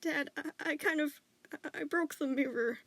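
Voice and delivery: high, stammering whimper; whimpering confession. A narrator's reading. Boy stammering a confession to his dad, whimpering. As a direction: whimper it out in halting bits. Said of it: "whimpered into his shoulder, stammering".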